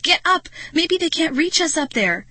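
high, urgent command; crying out. A narrator's reading. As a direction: urge your friend on in panic. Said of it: "I cried".

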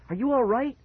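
high, anxious rising question; alarmed cry. Acted. Child checks on injured brother, crying out anxiously. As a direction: ask it high and urgently worried. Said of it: "I cried his name again and again".

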